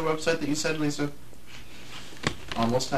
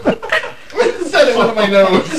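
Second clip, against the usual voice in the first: high, loud, laughing and choking; laughing so hard it hurts; laughing fit; 13 semitones higher, 11 dB louder than usual. Real, not acted. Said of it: "[name] is having a laughing seizure".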